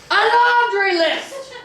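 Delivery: laundry stretched on a very high rising cry; shrieking disbelief. Acted. Captain repeating the memo's insult, very high slow outrage.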